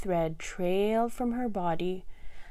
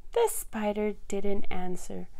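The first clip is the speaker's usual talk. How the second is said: plain reading voice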